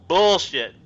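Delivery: sharp, loud dismissal; loud blunt retort. Real, not acted. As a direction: call it out loudly. Blunt outburst.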